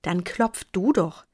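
slow, mildly testy retort; understated irritation; irritated. A narrator's reading. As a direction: throw the job back at him, annoyed. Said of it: "antwortete Tom gereizt".

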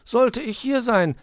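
high, small-character protest; sheepish. A narrator's reading. Weißohr defends turning up at eleven, abashed. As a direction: plead the agreement, abashed. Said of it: "sagte Weißohr betreten".

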